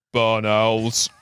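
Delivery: big, imposing mock voice; Henry. Imposing friend answers the dog walker, mock bellow. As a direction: announce it with slow, grand certainty. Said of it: "big imposing figure".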